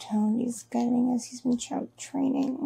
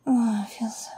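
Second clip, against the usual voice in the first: whispering. Acted. Intimate whispered confession.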